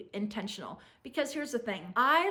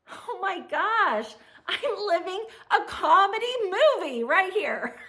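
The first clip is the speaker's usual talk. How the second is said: 1 excited exclaim; 6 semitones higher, 7 dB louder than usual. Pretend. Imagined excited exclaim to friends.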